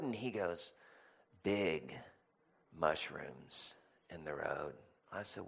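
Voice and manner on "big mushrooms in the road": low, slow and soft; son's earnest answer. Retold or imitated. Son clarifying for his puzzled dad, slow and hushed. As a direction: imitate a child explaining slowly and quietly.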